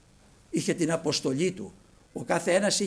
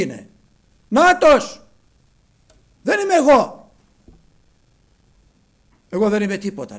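very high, loud, ringing voice; John's loud proclamation; 5 semitones higher, 14 dB louder than usual. Acted. John showing the crowd the Messiah, loud proclamation. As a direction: proclaim it with all your strength. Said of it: "λέει".

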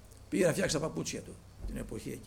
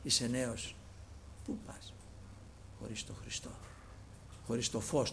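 soft, near-whispered, lower questioning; hushed question; 4 semitones lower, 9 dB quieter than usual. Retold or imitated. Shoemaker asking the young brother, hushed and earnest. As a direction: lean in and ask it gently, half-whispered. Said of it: "Του λέει".

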